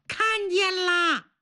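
put-on high, slow child's voice; small child. A narrator's reading. Small child answers the searching men, high childlike voice. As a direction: chirp it out like a small kid. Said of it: "有个小孩说".

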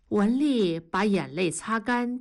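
usual speaking voice

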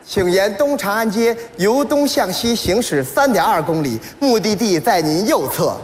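slow, evenly paced sat-nav directions; navigation voice. Retold or imitated. Standard sat-nav directing driver along route, measured voice. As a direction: read the route like a navigation system.

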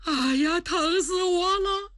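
high, pained wailing; crying in pain. A narrator's reading. The swollen-faced prince wails in pain to the empress. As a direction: whimper through the pain.

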